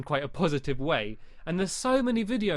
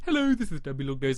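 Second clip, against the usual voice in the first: quick, odd put-on intro greeting; a lightly silly host voice; weird intro voice; 3 semitones lower, faster than usual. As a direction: say your channel intro in a silly voice. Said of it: "in a bit of a weird way".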